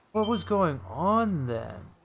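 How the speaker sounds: normal talking voice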